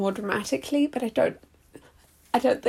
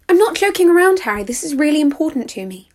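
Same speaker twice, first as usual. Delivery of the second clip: exasperated; 5 semitones higher, 4 dB louder than usual. Acted. Frustrated wife demanding attention.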